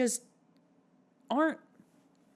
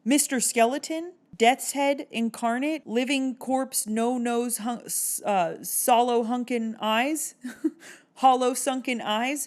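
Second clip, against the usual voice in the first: excited listing; 6 semitones higher, 4 dB louder than usual. Real, not acted. Listing the Phantom's horrors.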